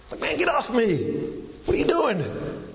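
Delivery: high, indignant get off me, hammed up; mock protest. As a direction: pretend to swat away the mud on your face. Healed man could have protested.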